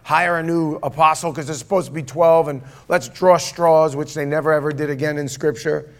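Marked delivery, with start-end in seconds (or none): 0.0-4.0 loud, impulsive butting in; rushing ahead. Acted. Peter pushing to replace an apostle, speaking out of turn. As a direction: jump in with an insistent, louder voice. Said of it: "speaking out of turn".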